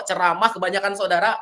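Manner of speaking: normal talking voice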